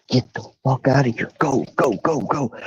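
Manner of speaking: pressed, fading urgency, go go go; urgent hushed order. Retold or imitated. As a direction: shove him out quietly in a hurry. Friend rushing him out in panic.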